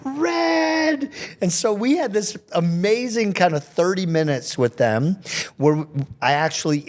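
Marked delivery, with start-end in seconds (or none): 0.0-1.1 put-on high, bright children's call; children shouting out the color; kids in chorus. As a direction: pipe it like a class of little kids. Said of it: "the kids are all going".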